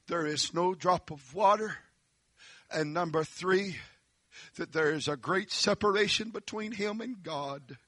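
ordinary talk

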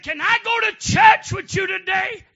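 high, loud excited plea; eager asking. Retold or imitated. Slave asking master about church, eager calling. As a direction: ask loudly, lifting the voice right up.

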